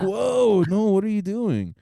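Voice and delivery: loud, high, startled objection; mock protest. Friends stop the yelling girl, loud mock protest. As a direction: burst in with an exaggerated whoa.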